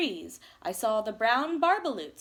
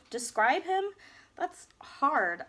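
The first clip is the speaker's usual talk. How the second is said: plain reading voice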